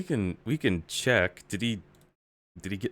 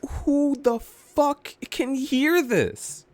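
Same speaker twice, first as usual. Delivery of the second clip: high-pitched, drawn-out outrage; incredulous exasperation; 12 semitones higher, slower than usual. Real, not acted. Viewer who cannot hear the example, exasperated. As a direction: stretch it out high in disbelief. Ranting at a too-quiet audio clip.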